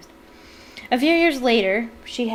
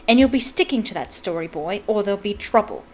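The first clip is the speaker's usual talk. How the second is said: usual speaking voice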